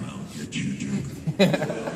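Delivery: whispered take breaking into a laugh. Shown as a demonstration. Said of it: "We have another take".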